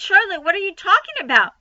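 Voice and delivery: high, fast alarmed plea; alarmed pig. Acted. Wilbur pleading with Charlotte, fast and high. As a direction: panic rising fast.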